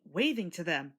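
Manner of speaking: plain reading voice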